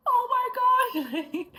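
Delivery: a long, drawn-out high shriek of disbelief; astonished cry. Retold or imitated. Reliving the moment she heard Texas again, stunned shriek.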